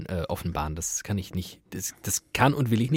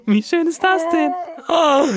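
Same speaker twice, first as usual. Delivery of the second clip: high, loud squeal of adoration; gushing delight; 7 dB louder than usual. Pretend. Host gushing over pupil's apple gift, squealing delight.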